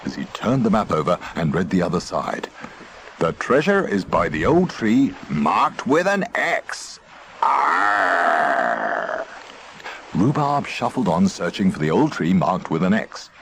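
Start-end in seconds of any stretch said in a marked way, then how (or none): 3.2-9.2 pirate voice. A narrator's reading. Pirate reads the map aloud.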